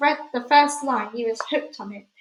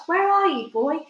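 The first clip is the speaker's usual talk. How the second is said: raised, higher-pitched narrated shout; 5 semitones higher, 4 dB louder than usual. A narrator's reading. Father searching for his invisible son, shouting. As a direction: call out for the missing boy. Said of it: "and he shouts".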